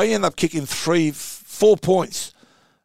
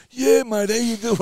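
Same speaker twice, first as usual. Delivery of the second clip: higher, strained, feeble reply; weak patients' reply; 6 semitones higher than usual. Retold or imitated. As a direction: croak it like a fellow post-op patient. Heart patients reply, mafia meeting.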